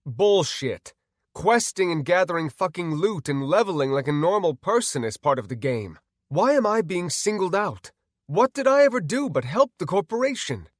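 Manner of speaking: loud, high, heated protest; indignant. Acted. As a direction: argue furiously against unfair treatment. Man argues with the doctor.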